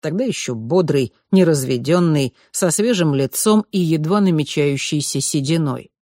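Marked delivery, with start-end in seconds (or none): none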